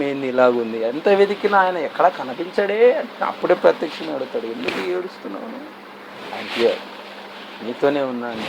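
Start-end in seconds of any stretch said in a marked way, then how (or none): 4.5-8.4 much softer, lower, tender reassurance; tender God. Acted. God gently telling the weeping woman he is here, soft. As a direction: hush it like a comforting presence.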